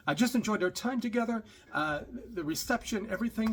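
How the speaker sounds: horrible accent impression, pitched high; Doctor Ruth. Passenger thanking her driver, bad mock accent. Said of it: "I'm doing a horrible accent here".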